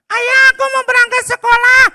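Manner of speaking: put-on high boy voice calling out, confused; child calling father. Boy Abdi calling his father, high and puzzled. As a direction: shout for dad like a lost little boy.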